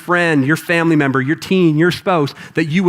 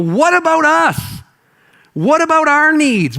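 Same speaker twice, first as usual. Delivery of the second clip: annoyed disciples; 8 semitones higher than usual. Acted. Disciples whining to Jesus about their own needs. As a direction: moan like fed-up, hungry followers. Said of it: "out of their minds annoyed".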